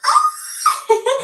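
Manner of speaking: a loud, high squeal of a laugh; high burst of laughter. Real, not acted. A high laughing shriek at an unknown name.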